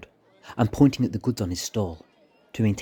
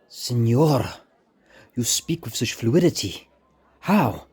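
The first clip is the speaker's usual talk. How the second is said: put-on Spanish accent; 3 semitones higher, slower than usual. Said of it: "said the man in his native tongue".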